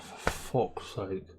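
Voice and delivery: slow, soft weary mutter; muttered to himself, fed up; exasperated mutter. Retold or imitated. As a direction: drag it out in defeat. Retelling his own fuming.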